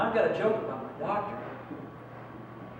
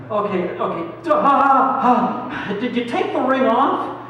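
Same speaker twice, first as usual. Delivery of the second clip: higher, louder jittery laughing chatter; nervous forced laugh; 6 semitones higher, 7 dB louder, slower than usual. Retold or imitated. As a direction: laugh nervously to hide the panic. Nervous patient on doctor's table.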